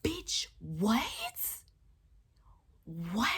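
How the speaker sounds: high, breathless whisper of disbelief; stunned whisper. Real, not acted. Stunned cashier reacting to the old man's lie, whispered disbelief. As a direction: hiss it out in shock, no voice.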